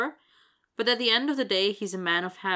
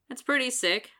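higher, lightly grinning voice; 6 semitones higher, 4 dB louder than usual. A narrator's reading. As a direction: say it through a grin. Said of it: "the smaller guy grins".

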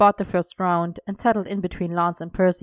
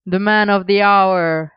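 loud, drawn-out triumphant cheer; big, loud celebratory announcement; crowing; 8 dB louder, slower than usual. A narrator's reading. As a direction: crow it out slowly to hail a friend. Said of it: "Elian crowed".

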